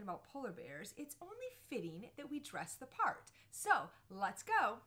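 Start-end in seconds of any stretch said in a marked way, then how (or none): 3.5-4.8 loud, slow, rallying cheer; rally the viewers into it, drawn out; big loud let's-go moment; excited call to go. Real, not acted. Dressing up as polar bear.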